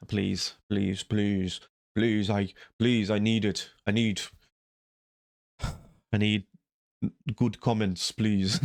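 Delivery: hammed-up pleading for comments, half laughing; mock begging. Vlogger begging viewers for good comments, mock pleading. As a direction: whine please please please like a desperate beggar. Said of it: "I beg".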